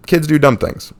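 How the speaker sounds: usual speaking voice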